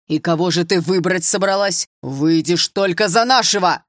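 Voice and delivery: loud, forceful demand; stern paternal order, slowing at the end; angry father. A narrator's reading. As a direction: lay down the law loudly. Father bangs fist on table.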